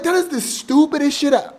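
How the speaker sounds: high-pitched disbelief at a stupid rule; incredulous scoff. Retold or imitated. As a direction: scoff at how dumb it is. Inner thought mocking the rule.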